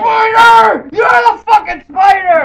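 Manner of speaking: high, loud yelling; screaming at a spider. Pretend. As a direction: hurl it at the spider.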